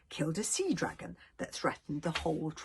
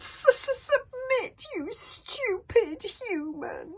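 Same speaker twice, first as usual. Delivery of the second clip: high stutter trailing into a slow whisper; hissing taunting dragon; 4 dB louder, much slower than usual. Acted. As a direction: sneer the insult like a small dragon. Seaslug taunting Hiccup.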